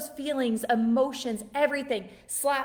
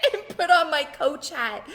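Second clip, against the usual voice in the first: laughing; 6 semitones higher, 4 dB louder than usual. Real, not acted. Laughs at her own hat switch.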